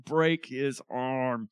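slow, drawn-out demand; very slow, deliberate order; frustrated demand. Acted. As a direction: stretch each word out in frustration. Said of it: "He's so frustrated".

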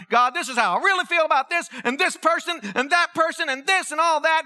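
high, heated mock rant; retold hollering. Venting to God about person after person, acted hollered tirade. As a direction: rattle off grievances at a high pitch, short of screaming. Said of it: "and I screamed and I hollered".

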